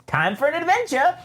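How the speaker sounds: high, gleeful droid voice; Triple Zero voice. Retold or imitated. Triple Zero cheerfully announces the slaughter in a high voice. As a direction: announce it with sinister glee. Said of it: "and he says".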